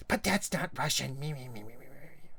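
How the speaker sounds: petulant imitation fading into mumbled gibberish; mock their objection, trailing away to nothing; belittling mimicry, dying off softly; mocking complaint. Mimicking interviewers riled by his trolling.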